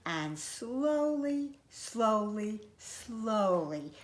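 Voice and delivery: soft, drawn-out words with pauses; slowly. A narrator's reading. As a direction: stretch each "slowly" out gently. Narration acts out slow walking.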